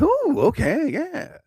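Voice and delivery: high, delighted ooh at the offer; mock-thrilled ooh, high; pleased surprise. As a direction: act like the offer caught him off guard, high. Player reacting to big offer.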